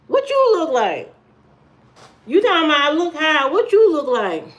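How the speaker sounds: high-pitched comeback; affronted, pitched well up; indignant retort. Real, not acted. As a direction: challenge the viewer right back. Retorts to viewer's insult.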